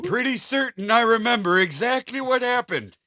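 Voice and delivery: high, loud playful voice; mock victim reply. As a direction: answer as the tired old victim. Joking reply as aged victim.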